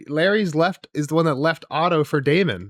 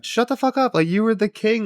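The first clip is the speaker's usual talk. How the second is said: higher, louder, mock furious retort to Aegon; mock angry outburst; 8 semitones higher, 4 dB louder than usual. Host imitates Larys telling Aegon off, mock angry. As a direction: snap back like an exasperated Larys, half in jest.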